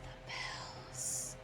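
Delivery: soft spooky whisper. Acted. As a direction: whisper the answer like a secret. Whispered punchline after question.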